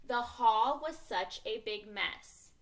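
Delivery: slow, loud read-aloud; emphatic teacher reading from the passage. Shown as a demonstration. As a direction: read it out deliberately to a class. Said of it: "keep going with my reading voice".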